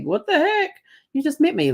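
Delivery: high-pitched startled "what the heck"; shocked squeal at a stranger's sudden cheek kiss; startled outrage. Retold or imitated. Startled by a stranger kissing her.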